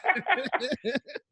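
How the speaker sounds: high, rising burst of laughter. Real, not acted. Host laughing about the Chewbacca bit, high laugh. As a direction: laugh at the memory.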